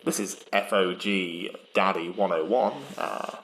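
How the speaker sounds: CB radio trucker voice; like talking into a CB radio; over walkie talkie. Retold or imitated. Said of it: "doing my best, like, impression of being on a radio".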